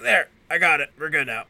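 high and loud, effortful; vampire declaring himself finished off; strained mock triumph. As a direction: push it out as you finish the job.

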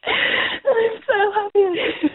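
happy crying. Real, not acted. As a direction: sob it out with joy. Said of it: "These are happy tears".

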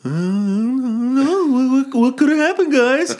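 mock-innocent Gollum whine; dumbass Smeagol. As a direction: play dumb in a high creature voice. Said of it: "dumbass look on his face like".

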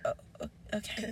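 very soft, faltering murmur; hushed stammer, almost no voice; meek retold reply. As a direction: imitate a crushed, tiny acceptance.

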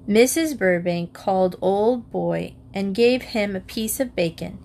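plain reading voice

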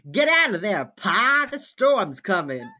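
put-on loud, high Brooklyn-accent impersonation; mock Brooklyn accent. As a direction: holler it in an exaggerated Brooklyn accent. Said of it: "sounds like he's from Brooklyn".

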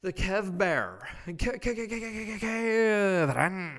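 building, stretched, high announcer-style calling of Kevin; hyped name call. Pretend. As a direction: hype him up like a ring announcer.

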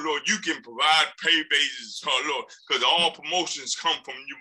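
fervent spoken prayer. Real, not acted. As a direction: pray aloud to the congregation.

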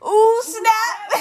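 high, loud "ooh, snap"; high, giggly mock exclamation; imitated exclamation. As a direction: mimic a hyped-up reaction through laughter. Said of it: "she goes".

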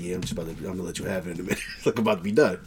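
mumbled brush-off. Retold or imitated. Imitates friend's mumbled brush-off.

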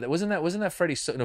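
ordinary talk

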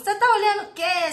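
loud, high mock insult from a brat; imitated angry child challenging someone; rude child's taunt. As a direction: snap it at the other kid like a cheeky boy. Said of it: "Ele tá falando assim".

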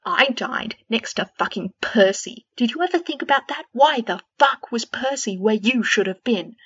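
loud, fast furious tirade; in a rage. A narrator's reading. Fred raging at George over Percy, loud tirade. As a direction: pour out the fury. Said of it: "Fred was in a rage now".

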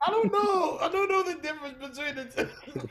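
high, loud, laughing helplessness; laughing protest. Real, not acted. Host teased about accents, high laughing protest.

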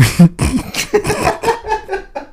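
long, high wheezing laugh; helpless laughter. Real, not acted. Podcast guest losing it mid-apology, helpless laughter. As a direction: lose it completely.

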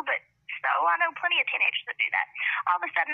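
ordinary talk

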